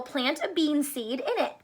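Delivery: usual speaking voice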